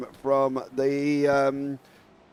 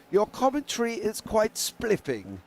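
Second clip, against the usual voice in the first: higher-pitched mock posh English; mock posh English accent; 6 semitones higher than usual. Co-commentator imagining an American's best English accent, mock posh. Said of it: "doing this in his best accent".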